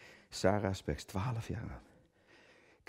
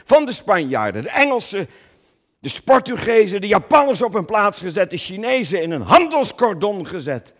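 raised, loud listing of conquered peoples; mock-heroic proclamation; 11 semitones higher, 10 dB louder than usual. Actor listing Coen's victories as a loud herald. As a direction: rattle off each nation like a herald.

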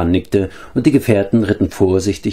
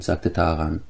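plain reading voice

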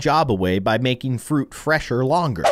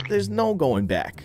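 usual speaking voice

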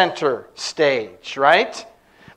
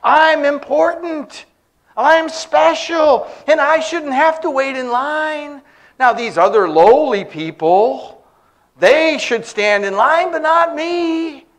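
high, drawn-out mock entitlement; hammed-up snooty indignation; demanding inner voice; 6 semitones higher than usual. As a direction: act self-important, stretching the key words. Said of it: "this voice inside of us that demands".

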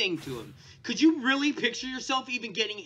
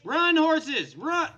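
high, loud warning call; urgent shout; 5 semitones higher, 6 dB louder, much slower than usual. Pretend. Player urging horses to flee, urgent shout. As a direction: call out in mock panic.

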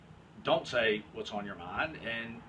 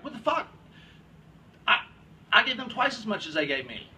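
mock indignant outburst; 8 semitones higher, 5 dB louder than usual. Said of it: "I'll be like".

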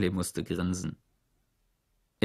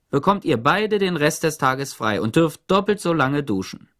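bossy Warden; 6 semitones higher, 5 dB louder than usual. A narrator's reading. Warden giving orders.

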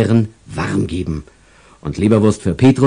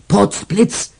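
Peter's exclamation; 11 semitones higher than usual. Retold or imitated.

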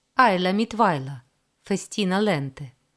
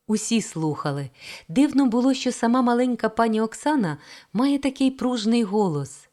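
ordinary delivery, nothing marked

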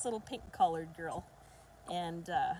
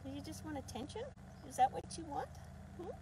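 put-on higher, sing-song baby talk; puppy talk; 7 semitones higher than usual. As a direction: coo the question at the puppy. Same question in puppy voice.

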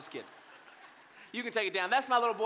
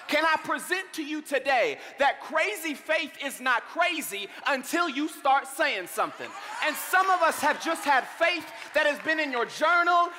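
loud, rising sermon climax; loud preacher calling out to the congregation; preaching at full force; 3 semitones higher, 4 dB louder than usual. Acted. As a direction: preach it hard and build. Preaching builds.

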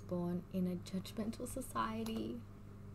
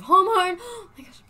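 excited gasp; 10 semitones higher, 14 dB louder than usual. Real, not acted. Delighted at a song choice.

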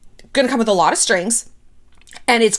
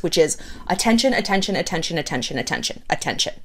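attention repeated, dropping lower and softer each time; fading repetition; 5 semitones lower, 5 dB quieter than usual. Acted. Mocking her need, fading repeated attention. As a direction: let the word attention fade away.

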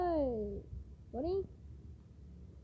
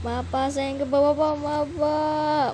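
loud pleading; 2 semitones higher, 7 dB louder, slower than usual. Acted. Student begs teacher.

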